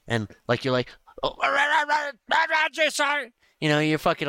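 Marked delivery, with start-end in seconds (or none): 1.2-3.3 high, flustered yelp of agreement; freaking out. Retold or imitated. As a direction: sputter it like a panicked recruit. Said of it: "freaking out a little bit".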